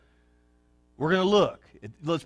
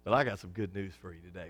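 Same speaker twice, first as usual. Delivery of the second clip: ordinary talk, nothing marked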